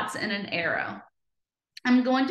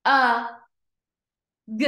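sounded out slowly; 5 dB louder than usual. Shown as a demonstration. Teacher reading a word slow, A and g sounded separately. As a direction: separate sounds A and g, long gaps between. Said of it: "read this one slow".

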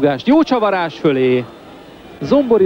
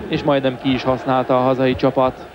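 ordinary talk

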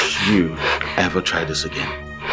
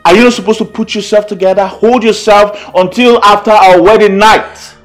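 indignant scolding; 4 semitones higher, 6 dB louder than usual. Acted. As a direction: scold with mounting volume and pitch. Man scolds partner in quarrel.

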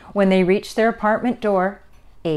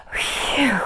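long blown-out breath; breathy "whew"; 6 semitones higher than usual. A narrator's reading. Mother and child calm down in noisy park, deep exhale. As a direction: blow out a falling "whew". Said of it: "let out a deep breath".

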